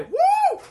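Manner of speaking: very high, loud crowd whoop; excited whoop. Acted. Fan cheers Jackie Chan, high whoop. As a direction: let out a fan's shrill-pitched woo.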